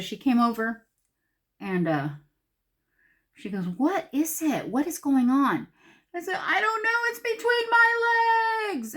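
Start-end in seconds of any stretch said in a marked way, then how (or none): 3.7-5.7 high, drawn-out demand; slow, emphatic, pitched-up questions; alarmed questioning. Retold or imitated. Daughter's retold alarmed question. 6.4-8.9 high, stretched-out mock shriek; squealing panic, last word held long; panicked screaming. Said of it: "I'm screaming in the camper".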